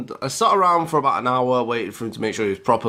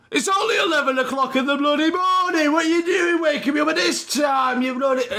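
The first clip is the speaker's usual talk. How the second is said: furious dad shout; 13 semitones higher than usual. Retold or imitated. Dad yelling at his son over the changed clocks, furious high shout. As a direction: blast the son for waking him up.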